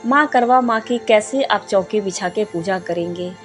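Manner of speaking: ordinary talk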